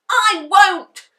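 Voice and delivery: high, loud defiant scream. A narrator's reading. Henry defying Mum, loud scream. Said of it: "screamed Henry".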